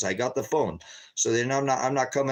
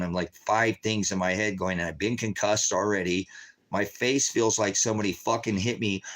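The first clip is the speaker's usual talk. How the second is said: normal talking voice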